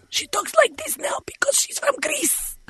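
hammed-up Greek accent impression; mock Lindsay Lohan Greek accent. Host mocking a celebrity friend, fake Greek accent. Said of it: "Has she adopted a new accent?".

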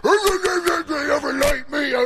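slow, drawn-out high shouted challenge; mock furious yell, stretched out; retold yell. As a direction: yell it in his face like picking a fight. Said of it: "you were at the top of your lungs".

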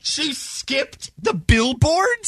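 high, slow astonished question; surprised. Real, not acted. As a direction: gasp out the news in disbelief. Disbelief at surprising news.

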